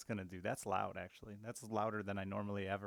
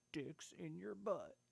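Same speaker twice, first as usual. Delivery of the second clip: higher, slow goofy put-on voice; silly high voice; 8 semitones higher, 5 dB quieter, slower than usual. Silly voice on repeated phrase.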